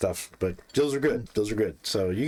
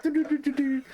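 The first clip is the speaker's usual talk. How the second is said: high mock slapping noises. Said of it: "So you're like".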